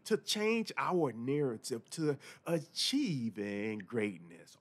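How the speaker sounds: normal talking voice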